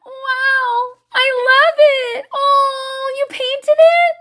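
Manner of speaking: high, sugary fake praise with a cracking laugh; mock cheerful mom. Mom fake-praising her kid's painting, high and strained. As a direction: gush over the kid's painting through gritted teeth. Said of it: "so it was like".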